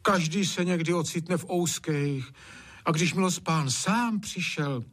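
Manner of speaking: high-pitched, imploring appeal; pleading. A narrator's reading. Wife pleads for the unlucky master, high imploring voice. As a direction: beg on the master's behalf.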